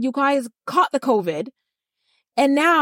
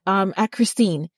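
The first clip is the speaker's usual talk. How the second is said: normal talking voice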